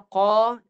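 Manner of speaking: qa kept in the mouth, crisp and loud; clear oral qa. Shown as a demonstration. Teacher shows the correct Qa in the mouth. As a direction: say qa from the mouth, not the nose. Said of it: "suara kat mulut".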